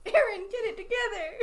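high voice, holding back laughter; laughing plea. Real, not acted. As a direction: scold playfully while trying not to laugh. Pleading with co-host amid laughing fit.

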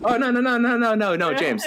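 high-pitched rapid pleading, hammed up; mock panic. Host begs cohost not to start the movie, mock panic. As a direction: play-act frantically stopping someone.

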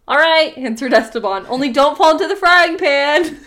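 cheerful Esteban. Acted. Esteban answers the voice calmly.

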